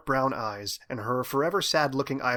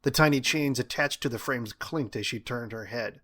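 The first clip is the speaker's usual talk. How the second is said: ordinary delivery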